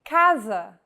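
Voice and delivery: "casa" said KAH-zah with a hard K; teacher models "casa" with a hard K sound. Shown as a demonstration. Teacher demonstrating for learners: "casa" with a hard K. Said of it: "the letter C sounds like this".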